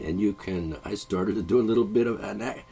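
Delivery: normal talking voice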